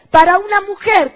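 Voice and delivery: ordinary talk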